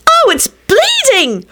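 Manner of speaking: high, drawn-out cry of alarm; terrified. A narrator's reading. Rosie seeing William's wound, high terrified cry. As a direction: wail it in horror at the sight. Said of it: "Rosie jumped up and looked terrified".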